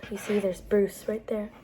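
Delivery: plain reading voice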